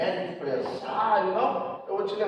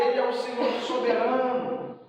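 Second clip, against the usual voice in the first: ordinary delivery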